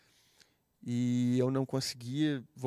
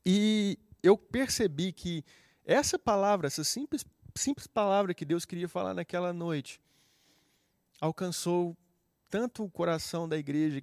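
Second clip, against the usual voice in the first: preaching climax; 4 semitones higher, 5 dB louder than usual. Acted. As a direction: build it up like a sermon climax. (clímax de pregação, alto e agudo)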